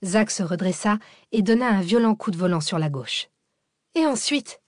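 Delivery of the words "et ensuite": high-pitched cry, little added volume; mild yell. A narrator's reading. Cécile asking the driver what comes next, mildly yelled. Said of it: "hurla Cécile".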